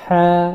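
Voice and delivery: "হ্যাঁ" as a tight mid-throat Arabic ha; pressed throat H. Shown as a demonstration. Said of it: "এভাবে বলতে হবে".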